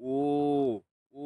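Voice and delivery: "ও" as a long, held o vowel. Shown as a demonstration. Teacher sounding out pinyin W, long drawn "ও". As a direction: hold "ও" out as one long round vowel. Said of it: "উচ্চারণ হবে".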